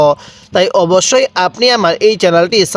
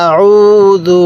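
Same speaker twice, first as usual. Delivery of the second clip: আউযু very slow, middle vowel held level; one-alif elongation; 4 dB louder, much slower than usual. Shown as a demonstration. Teacher asking learner to repeat along, drawn-out আউযু. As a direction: chant আউযু slowly, stretching the উ. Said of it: "আমার সাথে সাথে পড়ুন".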